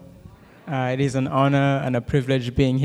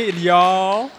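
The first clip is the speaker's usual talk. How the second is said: loud, high, long Southern Y'ALL; exaggerated Southern drawl on "y'all"; drawn-out Southern accent; 6 semitones higher, 6 dB louder than usual. Shown as a demonstration. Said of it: "a little longer maybe".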